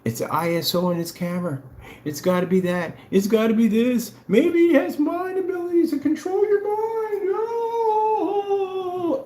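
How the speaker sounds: high, whiny crying complaint; fake-sob the excuses a sore loser makes; mock Karen cries. Mocking rivals' future excuses, high whiny fake cry. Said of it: "the Karen cries ahead of time".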